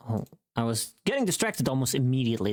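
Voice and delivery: usual speaking voice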